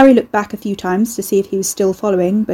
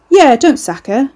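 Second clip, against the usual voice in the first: high, loud, slow and mocking; louder; 6 semitones higher, 7 dB louder than usual. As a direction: say it loud for the room. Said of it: "Ricky said louder".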